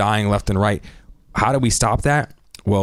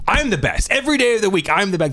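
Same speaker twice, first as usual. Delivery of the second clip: higher, brash champion bragging; cocky boast; 10 semitones higher than usual. Retold or imitated. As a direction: play the swaggering fighter. Cocky fighter boasting, mock quote.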